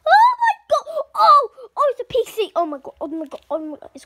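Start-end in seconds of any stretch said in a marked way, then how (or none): 0.1-1.9 high, loud, laughing squeal of joy; excited shriek. Pretend. Child opening a birthday present, squealing with joy. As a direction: shriek with delight at the gift.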